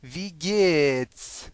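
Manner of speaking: "وی گیتس" stretched into long syllables; very slow model. Shown as a demonstration. Teacher introduces German how-are-you, "وی گیتس" very slow and drawn out. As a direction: draw out both vowels of "وی گیتس" for learners.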